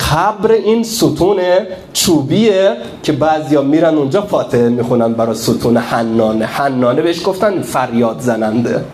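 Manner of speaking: normal talking voice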